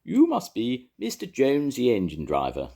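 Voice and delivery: high, loud posh lady voice; grand lady. A narrator's reading. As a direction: boom it out like a lady in a hat.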